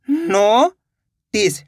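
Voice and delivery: "Notice" split into a long rising "नो", pause, then "टिस"; syllable by syllable. Shown as a demonstration. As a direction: sound out "नो ... टिस" very slowly. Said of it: "दोनों को मिला देंगे तो notice". ("Notice" को तोड़कर धीरे-धीरे: नो ... टिस)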